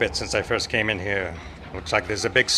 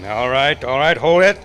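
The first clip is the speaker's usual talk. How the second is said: louder, rising hold it; cutting in; 3 semitones higher, 6 dB louder than usual. Acted. Marshal cutting off the rambling lawmen, louder. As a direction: stop them mid-ramble.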